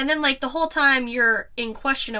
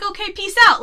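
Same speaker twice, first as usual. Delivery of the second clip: breezy; 9 semitones higher, 8 dB louder than usual. Retold or imitated. Quoting character leaving scene.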